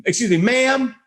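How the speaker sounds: high, prim and overly polite; polite fussy voice. Retold or imitated. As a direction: flag down the barista with exaggerated politeness. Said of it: "most polite, fussy voice".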